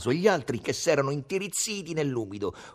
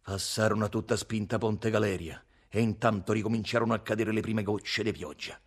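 ordinary delivery

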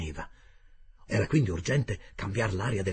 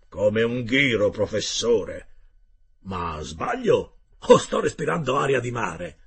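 slow, loud rough sailor voice; a hearty sailor, loud and slow; gruff harpooner Ned; 6 dB louder, slower than usual. Acted. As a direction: play the blunt Canadian harpooner, drawn out. Ned Land's voice in dialogue.

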